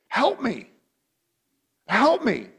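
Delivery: put-on high, loud whiny child crying for help; child's plea. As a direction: wail it like a frustrated little kid.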